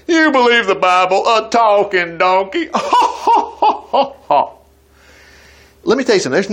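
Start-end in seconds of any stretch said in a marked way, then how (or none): 0.1-2.7 mildly mock the doubter's sneer; mocking sceptic. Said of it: "some people, they think". 2.8-4.5 high scornful laugh at the talking donkey; mock scoffing laugh. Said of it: "some people, they think".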